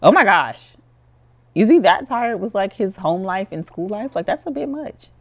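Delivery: loud, high-pitched disbelief; shocked. Real, not acted. Player seeing Joey fall out from exhaustion, shocked. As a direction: exclaim it, taken aback, voice jumping up. Reacts to character collapsing from exhaustion.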